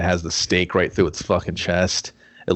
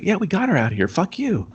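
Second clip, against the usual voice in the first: mocking taunt; 11 semitones higher than usual. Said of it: "the movie's like".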